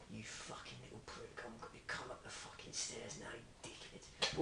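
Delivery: very soft, low mock menace; quiet menacing threat. Comparing which threat is scarier, hushed menace. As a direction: threaten under your breath.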